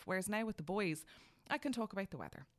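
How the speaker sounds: usual speaking voice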